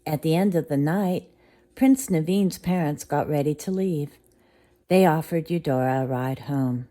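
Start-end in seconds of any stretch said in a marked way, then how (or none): none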